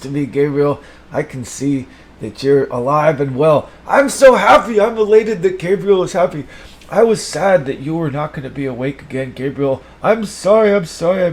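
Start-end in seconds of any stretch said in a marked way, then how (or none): 3.9-7.7 excited. Acted. Joy at fish revived.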